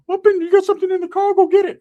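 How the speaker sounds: high, loud cartoonish cousin voice; cousin Ricky voice. Cousin Ricky pulling him aside, in a high squeaky voice.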